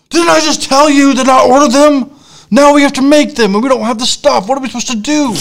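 high, loud, furious mock rant; hammed-up screaming meltdown; freaking out. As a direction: blow up at them, shouting high. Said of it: "I would start freaking out".

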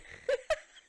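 high, tearful laughing fit. Real, not acted.

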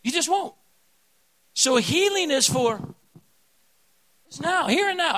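normal talking voice

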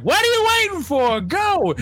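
put-on very high, impatient child voice; little girl yelling. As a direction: squeal it like a bossy kid. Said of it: "and she's like".

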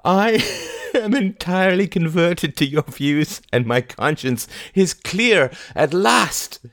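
high, laughing through the words. A narrator's reading. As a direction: laugh as you say it. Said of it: "laughing loud and clear".

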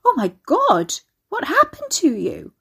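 high, loud, horrified cry; gasping, stretched-out shriek of shock; shocked horror. A narrator's reading. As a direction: recoil in horror as you say it. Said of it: "Carrie gasped, shrinking away".

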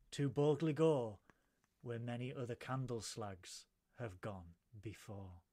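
measured, solemn space-voyage narration; parody opening monologue. Acted. Host parodies the starship opening, hushed solemn monologue. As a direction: start in a whisper, then intone with long pauses.

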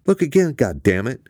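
high-pitched exasperated yell; angry shout. A narrator's reading. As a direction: shout it as a furious demand. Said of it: "she shouted".

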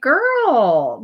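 put-on high, drawn-out disapproving girl; scolding girl. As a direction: chide her like a friend. Scolding a character, exasperated.

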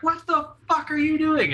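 high shrill-pitched mom yelling; mock mom yell. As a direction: screech it like the furious mom. Mom bursting into the garage.

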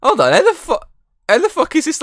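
high, loud disbelief; exasperated, voice shooting way up; incredulous outburst. Acted. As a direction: blurt it out in high-pitched bafflement. Acting out baffled reaction to ratings.

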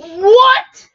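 high, loud "what" of disbelief; shocked outburst. Real, not acted. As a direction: yell it in shock. Shocked reaction to a revelation.

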